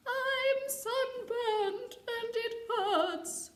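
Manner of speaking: high, slow, sung-out mermaid cry. Acted. Mermaid wailing to the flying doctors in pain. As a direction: wail it like a sunburned mermaid. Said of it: "she cried".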